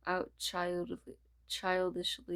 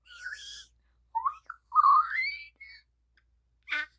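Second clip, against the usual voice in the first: much higher squeak; squealing disbelief; 7 dB louder, faster than usual. Acted. Character squeaks in amazed disbelief.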